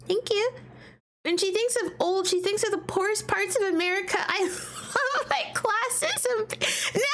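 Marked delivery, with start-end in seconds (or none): 4.4-7.1 laughing through the words, higher, slow; real laughter through the line. Real, not acted. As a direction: giggle it out. Laughing at own joke.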